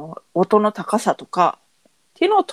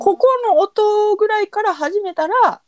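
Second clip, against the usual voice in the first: starts ここの音 high and slow to show a pitch; a high, slow sample of the starting pitch; pitch demo; 11 semitones higher than usual. Shown as a demonstration.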